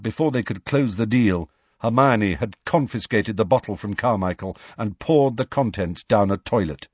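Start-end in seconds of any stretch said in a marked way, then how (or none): none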